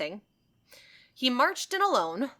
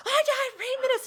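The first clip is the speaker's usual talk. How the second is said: horrified patient; 4 dB louder than usual. Retold or imitated. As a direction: wail it in mock horror. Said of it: "And he's like".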